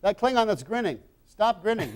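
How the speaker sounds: director's call. Retold or imitated. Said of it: "they'd be go".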